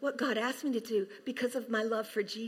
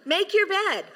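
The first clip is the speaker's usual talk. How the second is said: much higher and louder, urging; emphatic command; 7 semitones higher, 4 dB louder than usual. Real, not acted. Pastor urging the congregation to tidy up, loud and high. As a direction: insist on YOUR bed.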